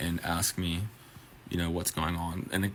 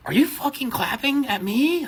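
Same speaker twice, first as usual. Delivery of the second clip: much higher, slow outraged mock of the drunk woman; mad; 4 dB louder, slower than usual. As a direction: stretch it out, offended and furious. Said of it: "Like, mad at me".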